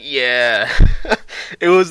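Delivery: loud cheer breaking into a laugh. Real, not acted. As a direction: cheer back and crack up. Rocking back at the actor.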